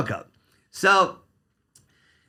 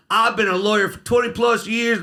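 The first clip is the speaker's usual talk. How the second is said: a hammed-up Southern drawl, pitched higher and drawn out; a lightly mocking Southern drawl; 6 semitones higher than usual. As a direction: imitate a lawyer laying on a Southern drawl for the board. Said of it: "in a Southern drawl".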